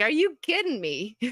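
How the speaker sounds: mildly acted indignant question; retold upset. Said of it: "I was so upset. I was like".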